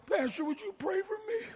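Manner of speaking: high, soft, whimpering mock plea; imitating pitiful pleading. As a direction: plead meekly in a small, high voice. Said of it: "why are you acting like that?".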